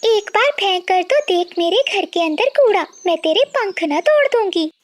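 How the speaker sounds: high-pitched angry neighbour; high, feisty bird character; quarrelsome bird, threatening. Acted. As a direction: threaten her in a high, scolding voice. Voices Priya threatening her neighbour.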